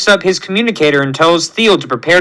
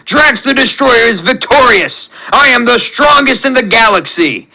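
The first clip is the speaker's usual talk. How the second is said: high, exultant warrior boast; Drax's victory scream; 7 semitones higher than usual. A narrator's reading. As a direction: crow in triumph with a big laugh. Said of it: "he screams in victory".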